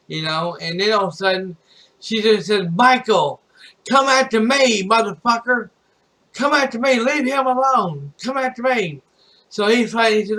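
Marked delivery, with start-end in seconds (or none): 2.7-5.7 high-pitched angry challenge, raised voice; mock yell, pitched well up; retold yelling. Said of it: "she goes off and yells at Michael". 6.3-9.0 high, insistent mock yell; raised-pitch confrontational outcry; retold yelling. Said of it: "yells at Michael and tells Michael to leave him alone".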